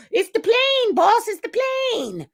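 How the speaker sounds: high, excited sidekick yelling about the plane; TV sidekick impression. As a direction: shout it like an excited little butler spotting a plane. Mimics famous TV sidekick line.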